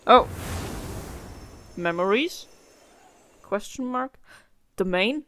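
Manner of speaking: ordinary delivery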